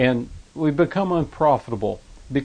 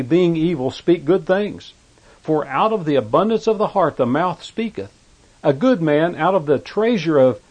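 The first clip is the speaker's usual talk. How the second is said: high, loud preacher's reading; preacher ringing out scripture; forceful scripture declamation; 5 semitones higher, 5 dB louder than usual. Acted. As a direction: proclaim the verse with raised voice. Declaims scripture at raised pitch.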